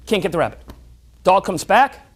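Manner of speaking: ordinary talk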